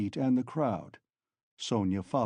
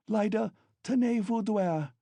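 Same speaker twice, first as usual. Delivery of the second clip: French; 9 semitones higher than usual. Acted. French command to child.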